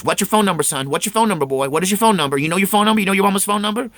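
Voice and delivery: high, loud urgent questions; frantic questioning. Retold or imitated. Mother shaking the boy for a number, high frantic questions. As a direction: shake the answer out of the boy. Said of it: "shakes me and goes".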